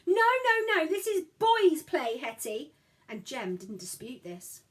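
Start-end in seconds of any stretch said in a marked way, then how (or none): 0.1-2.7 put-on high, loud child's scream of no; a boy shrieks to keep his sister out. A narrator's reading. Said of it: "Saul screamed".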